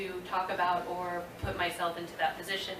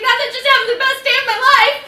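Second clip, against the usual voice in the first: sarcastic cheer; 15 dB louder, faster than usual. Retold or imitated. Sarcastic reply to director.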